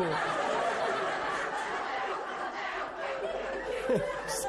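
carcajada larga y sin voz. Real, not acted. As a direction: se descostilla de risa tras el remate. Se ríe tras el remate. (risa prolongada, de verdad)

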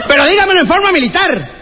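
mock sergeant bark. Sergeant barking orders.

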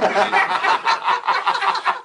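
big high laugh; loud high laugh. Real, not acted. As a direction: burst of high cackling laughter. Laugh at own joke.

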